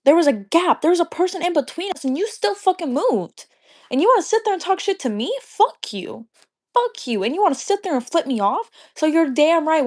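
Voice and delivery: fuming rant, pitch jumping high; pissed off. Real, not acted. Venting at the absent dancer who complained, pissed off and high-pitched. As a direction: rant indignantly, swooping up on the accusations. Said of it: "I was so pissed off".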